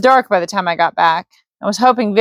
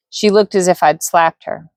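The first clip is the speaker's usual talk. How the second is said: usual speaking voice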